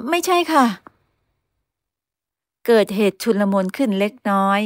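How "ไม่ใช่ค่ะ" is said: much higher, startled no; girl quickly denying the mother's suspicion; flustered denial. Acted. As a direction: blurt the denial in alarm.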